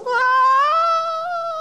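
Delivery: high long yell of someone dropping off a cliff; falling scream. Retold or imitated. Jumper mid-fall, a high falling scream. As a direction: scream as you plunge.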